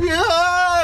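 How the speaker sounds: loud high panicked scream; scared yell. Retold or imitated. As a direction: let out a frightened yell. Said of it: "이러면서".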